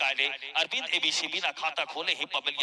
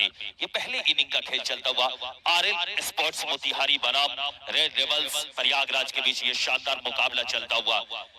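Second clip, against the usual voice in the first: ordinary delivery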